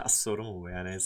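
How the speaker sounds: quiet, laughing through the question; soft amused scoff; laughing disbelief. Real, not acted. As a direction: chuckle it out in disbelief.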